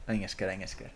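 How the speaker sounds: soft, low little I'm scared; quiet mock fright. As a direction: mutter it under your breath. Playful scared repetitions.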